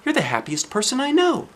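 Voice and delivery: can't believe she's sad; surprised Mister Happy. Acted.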